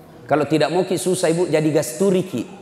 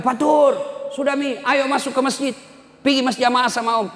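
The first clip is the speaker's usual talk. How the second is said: imitated call, higher; 9 semitones higher than usual. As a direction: call the boy in from the field. Uncle calling child home.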